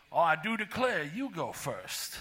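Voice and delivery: lightly put-on Southern belle drawl, dragging the last words slow; a hint of a Southern accent, slowing right down. Driver waving another through, in a mock Southern drawl. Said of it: "with a slow Southern drawl".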